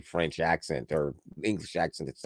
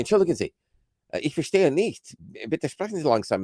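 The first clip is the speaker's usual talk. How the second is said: higher-pitched, polite High German phrases; careful learner's High German in a raised voice; 7 semitones higher than usual. Retold or imitated. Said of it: "do you speak Low German".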